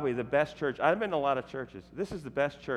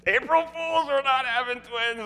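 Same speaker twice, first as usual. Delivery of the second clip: high, slow singsong gotcha; spring the joke on them; gleeful reveal; 12 semitones higher than usual. Retold or imitated. Couple revealing the prank to friends, high singsong. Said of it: "we go".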